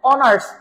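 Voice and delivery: অনার্স pronounced from the vowel, no h sound; sounding out honours. Shown as a demonstration. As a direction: demonstrate অনার্স with no h at the start. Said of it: "কি বলি?".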